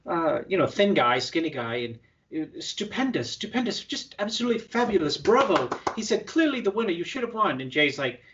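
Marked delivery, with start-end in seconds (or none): 2.6-5.7 high-pitched mock British gushing; British accent. English guest praising Jay after the show, put-on British accent. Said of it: "in his British accent". 6.3-7.6 lightly imitated British accent, pitched up. English guest telling Jay he deserved it, light British accent. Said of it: "in his British accent".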